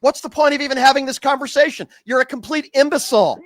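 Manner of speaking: high, heated insults at the caller; angry insult. Real, not acted. Radio host losing patience with a caller, angry and high.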